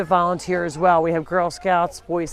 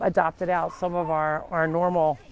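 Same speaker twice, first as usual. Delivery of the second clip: usual speaking voice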